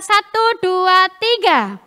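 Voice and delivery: loud, drawn-out count-in. Real, not acted. Preacher cues children to recite the memory verse, loud count-in. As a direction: cue the whole group to start together.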